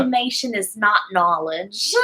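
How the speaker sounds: put-on Staten Island accent; New York accent. Shown as a demonstration. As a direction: say it in your best exaggerated New York accent. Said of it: "in your best New York accent".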